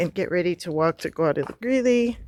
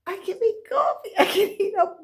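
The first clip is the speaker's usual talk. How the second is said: high delight; 11 semitones higher than usual. Real, not acted. Delight at having power.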